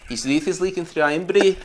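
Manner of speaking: attempted Scottish burr; put-on Scottish; mock Scottish accent. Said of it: "I reckon Scottish".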